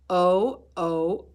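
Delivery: "O, O" said as the letter name, long; teacher modelling the long o sound. Shown as a demonstration. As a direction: stretch "O" like its own name. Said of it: "Here's the long sound of O".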